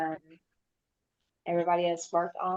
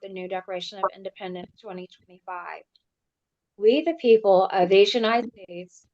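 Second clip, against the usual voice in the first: formal proclamation; 7 dB louder than usual. Acted. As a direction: announce it to the assembly. Reads a formal declaration.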